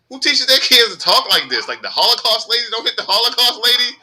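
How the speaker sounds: very high, loud retold outrage; appalled disbelief. Driver reacting to the kids' talk, high appalled disbelief. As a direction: protest high and loud, scandalized by the words. Retelling outrage at kid's words.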